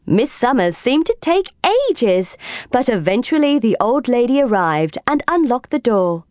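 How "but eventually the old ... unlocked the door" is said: ordinary delivery, nothing marked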